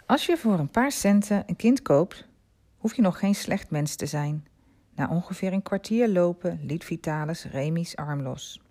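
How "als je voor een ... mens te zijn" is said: plain reading voice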